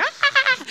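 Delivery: high squealing yelp, imitating a ticklish kid; mock ticklish shriek. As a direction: shriek like a child whose foot is touched. Son reacting to toenail cutting.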